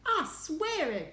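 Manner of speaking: high, loud, drawn-out protest; desperate cry. A narrator's reading. Astronaut insisting he is telling the truth, desperate cry. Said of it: "cried poor Shuckworth".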